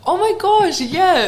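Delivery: light up at the question; excited gasp. Real, not acted. Asked about her show, a gasping excited oh my gosh.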